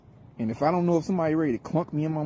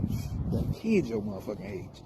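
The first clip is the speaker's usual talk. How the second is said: whispered, softer thought; inner thought; 4 semitones lower, 5 dB quieter than usual. Retold or imitated. To the young caller, a whispered private thought. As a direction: mutter it under your breath. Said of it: "I was saying it in my head".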